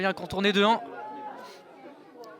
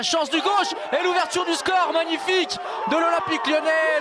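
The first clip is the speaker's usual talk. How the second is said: high, loud goal shout; excited goal call; 8 semitones higher, 4 dB louder than usual. Real, not acted.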